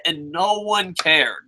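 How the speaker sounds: ordinary delivery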